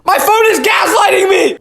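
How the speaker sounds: high, loud mock outrage; exasperated shout. Real, not acted. Yelling at his cracked phone camera, comic outrage. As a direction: yell it at the phone like it betrayed you. Trick keeps failing.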